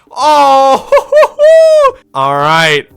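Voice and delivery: loud, delighted laughing shout; excited shout. Real, not acted. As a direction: whoop with sudden joy. Great draft pick luck.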